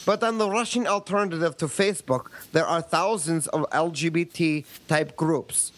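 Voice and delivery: put-on Russian accent reading a listener email; a light Russian accent; mock Russian accent. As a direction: read it as a Russian would, hammed-up. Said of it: "You can drop the accent now".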